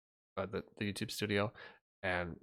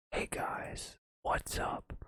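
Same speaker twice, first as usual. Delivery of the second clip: mock streamer intro, whispered; whispered greeting; 5 dB quieter, much slower than usual. Shown as a demonstration. Streamer greeting viewers in a whisper. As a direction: whisper a cheery hello to viewers. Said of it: "talk like this".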